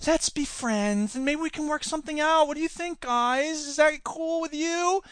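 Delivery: timid Clinton. Retold or imitated. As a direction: meekly beg the other side for approval. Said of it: "Clinton got into office".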